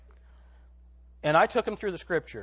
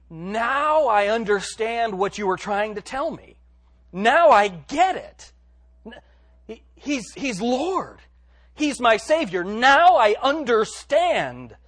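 loud, high, drawn-out exclamations; elated new convert, proclaiming it loudly; excited realization; 7 semitones higher, 8 dB louder, slower than usual. Acted. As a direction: burst out as if it has just dawned on you. Acting out newly saved believer's excitement.

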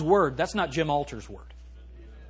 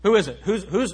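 ordinary talk

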